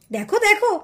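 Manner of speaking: usual speaking voice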